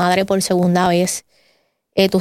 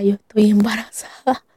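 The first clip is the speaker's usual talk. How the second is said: breathless unvoiced whisper of disbelief; stunned whisper. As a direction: barely get the words out, in shock. Telling husband she is pregnant.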